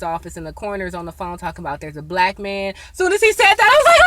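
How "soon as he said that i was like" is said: high, loud, worked-up telling; excited. Real, not acted. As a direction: build up breathlessly. Retelling scream at coroner news.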